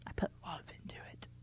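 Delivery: quick unvoiced whisper. Real, not acted. Reader confiding a joke to listeners in a whisper. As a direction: breathe it out as a secret aside.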